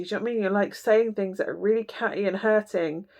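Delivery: ordinary delivery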